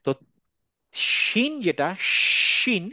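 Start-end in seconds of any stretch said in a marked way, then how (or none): 2.0-2.8 শিন with a long breathy sh from the middle of the tongue; sheen sound. Shown as a demonstration. Teacher demonstrates শিন's airy sh to students. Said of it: "জিভের মাঝামাঝি জায়গা থেকে".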